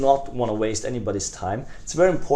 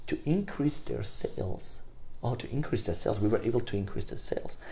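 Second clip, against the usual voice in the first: taken a notch back; 9 dB quieter than usual. Shown as a demonstration. Salesman lowering the voice to a prospect, much softer. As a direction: drop the voice right down. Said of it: "take it a notch back".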